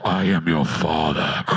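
very deep, loud masked-villain voice; mock movie villain. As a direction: boom it low through a breathing mask. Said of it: "I just had this moment of".

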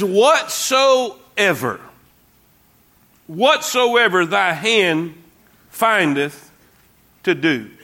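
very slow, weighty verse reading; slow scripture reading. Acted. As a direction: drag out each word of the verse.

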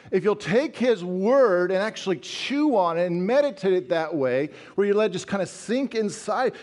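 ordinary delivery

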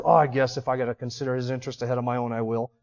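low, quick, soft reluctant grumble; grudging, through gritted teeth. Acted. Preacher mimics someone forced to help others, grudging and low. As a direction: give in grudgingly under your breath. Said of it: "Gritting our teeth".

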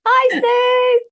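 very high, shouted greeting; energetic hello. Real, not acted. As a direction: burst out with an excited hi. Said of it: "energetic hello as you did".

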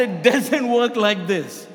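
slow, forceful "doesn't work like this"; emphatic insistence. Retold or imitated. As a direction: draw out "doesn't work like this" firmly and loudly. Insists to man pressing for proof.

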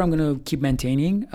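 ordinary talk, nothing marked

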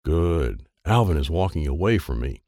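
ordinary delivery, nothing marked

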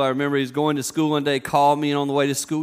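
ordinary talk

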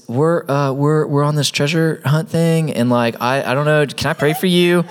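high-pitched, stumbling mock nerves; awkward, nervous. Nervous first-timer asking to pray for a stranger, awkward. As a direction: imitate an unsure beginner fumbling up to a request. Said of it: "he is so awkward, so nervous".

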